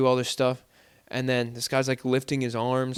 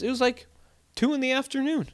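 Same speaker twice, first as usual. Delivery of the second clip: ordinary delivery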